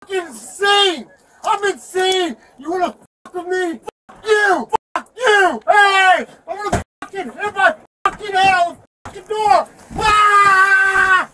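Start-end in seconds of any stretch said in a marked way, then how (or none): 1.4-11.3 loud, higher enraged screaming; furious yelling. Real, not acted. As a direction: rage at him, nearly out of control. Arrestee raging in police car.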